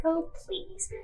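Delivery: light scoff. Acted. Host brushes off guests' polite refusal, scoffing lightly. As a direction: wave it away with a gentle, airy scoff. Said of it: "scoffs at you".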